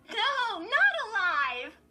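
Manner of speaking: very high, loud gleeful call. A narrator's reading. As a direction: crow it with glee, drawn out. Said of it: "Lindy called gleefully".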